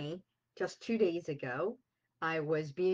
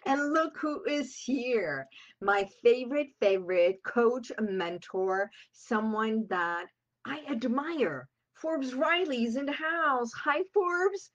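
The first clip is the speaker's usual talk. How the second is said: excited; 7 semitones higher, 5 dB louder than usual. Real, not acted. Greeting her mentor on air.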